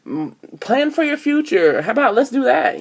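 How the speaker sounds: high preachy motherly lecture; mock sensible advice. [name] advising friends to stay home, high mock-preachy. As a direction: lecture them sweetly in a high voice. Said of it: "And I'm like".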